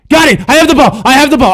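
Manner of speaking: high, loud elated yelling; triumphant shout. Real, not acted. Player finally has the ball, elated shouting. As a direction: crow it out in excitement.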